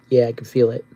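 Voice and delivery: ordinary delivery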